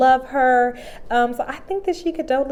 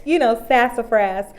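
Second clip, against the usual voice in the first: louder, higher hype; cheering her on; 4 semitones higher, 7 dB louder than usual. Retold or imitated. Cheering on the sassy grandma, louder and higher. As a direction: egg the grandma on, loud and high. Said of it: "I'm just like".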